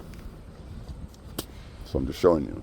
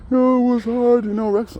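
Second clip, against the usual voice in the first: mock whiny grief; 7 dB louder than usual. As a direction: drag it out, squeaky and sorry for himself. Mocking the guy again.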